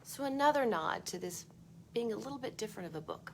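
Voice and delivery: usual speaking voice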